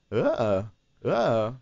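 a hint of Walken's clipped uh, uh; halting uh, uh like Walken; Christopher Walken's uh. Shown as a demonstration. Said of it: "the song that... is in his voice".

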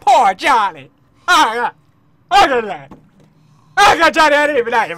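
loud, hammed-up crying; mock wailing. Player mourns Johnny whom he never liked, fake sobbing. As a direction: bawl in exaggerated fake grief.

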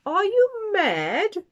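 very high, loud and slow bellow; loud slow outraged roar; booming ringmaster. A narrator's reading. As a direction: boom it out at the foolish customer. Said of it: "boomed the ringmaster".

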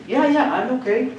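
quick reassurance in an imitated child's voice; imitated kid. Small kid answers worried theater director, imitated high reassurance.

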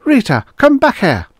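high, loud angry call; cross woman calling out, pitched high; calling angrily. A narrator's reading. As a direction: scold a child from back in a crowd. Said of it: "a woman in the third rank of the crowd called angrily".

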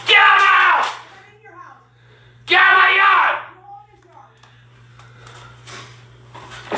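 real enraged shouting; furious shout. Real, not acted. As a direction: bellow to drive them off. Ordering neighbour off his property.

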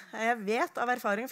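ordinary delivery

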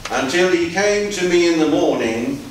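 ordinary talk

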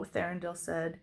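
ordinary delivery, nothing marked

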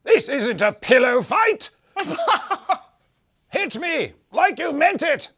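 very high, loud, slow booming taunt; booming arrogant alien overlord. Acted. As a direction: bellow it out like a mocking giant. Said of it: "a booming voice issues from it and says".

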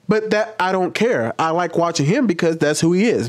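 ordinary talk

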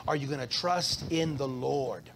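ordinary talk, nothing marked

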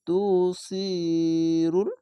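"তু সি রুল" recited with "সি" sustained; elongated vowel. Shown as a demonstration. Teacher shows the ya stretch, long-held "সি". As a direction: draw out the long i of "সি" before "রুল". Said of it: "এক আলিফ টেনে পড়তে হবে".

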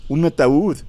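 "un ataúd" high and loud, rising at the end; a frightened doctor's peak; terrified cry. Acted. Doctor's climax of terror.

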